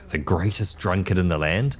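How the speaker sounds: usual speaking voice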